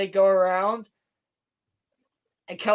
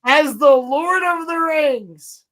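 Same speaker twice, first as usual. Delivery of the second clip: much higher and louder, slow and grand; excited proclaiming; 7 semitones higher, 5 dB louder than usual. Real, not acted. Hyped friend repeating the big title reveal. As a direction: announce it like a thrilling reveal. Excited reaction to show line.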